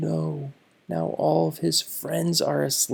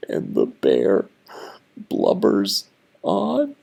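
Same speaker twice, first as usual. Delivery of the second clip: mock blubbering; 4 semitones lower, 4 dB louder than usual. A narrator's reading. Reading to kids, hammed-up crying bear. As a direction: ham up the bear's crying.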